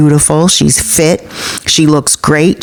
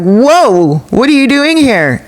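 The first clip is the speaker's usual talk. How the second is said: startled, higher-pitched reaction; retold shock, pitched up; 6 semitones higher, slower than usual. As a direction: mimic someone caught off guard. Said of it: "In shock".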